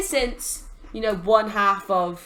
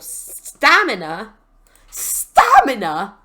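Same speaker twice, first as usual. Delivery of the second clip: drawn-out, louder incredulous repeat; 9 dB louder, much slower than usual. Acted. Player questioning a penalty for stamina, incredulous. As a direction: stretch the word out in loud disbelief. Mock outrage at wrestling penalty.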